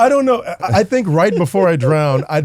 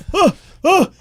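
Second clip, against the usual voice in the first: man overboard shout; 13 semitones higher than usual. Acted. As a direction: shout for rescue from the water. Improv drowning man calling out.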